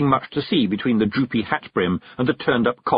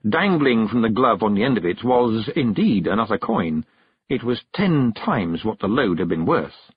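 usual speaking voice

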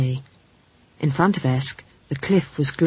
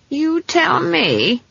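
high, slow, leading reply; cryptic; 11 semitones higher, much slower than usual. Acted. Granny answers the doubting girl, slow and cryptic. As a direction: draw it out knowingly, pitched up. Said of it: "said Granny cryptically".

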